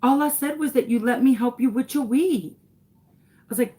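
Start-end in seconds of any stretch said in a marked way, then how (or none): 0.0-2.5 lightly voiced small boy, higher pitched; child's voice. Son repeating himself to mom, lightly imitated child. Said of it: "He said".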